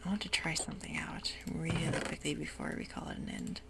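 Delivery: ordinary talk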